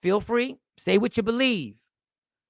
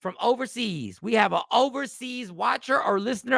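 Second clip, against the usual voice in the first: ordinary talk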